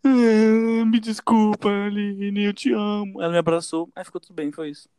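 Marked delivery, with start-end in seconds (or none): 0.0-3.2 high, wailing tearful apology, hammed up; mock crying. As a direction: sob it out like a little kid, exaggerated. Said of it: "depois eu comecei a chorar". (choro fingido, pedindo desculpa aos berros)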